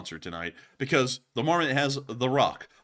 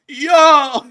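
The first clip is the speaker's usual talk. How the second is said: shouted yo; 16 dB louder than usual. Retold or imitated. Repeating the yo shout.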